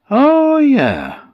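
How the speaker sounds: high, bright fart-creature voice; a jolly little fart greeting her; cheery fart creature. Acted. The fart character speaks.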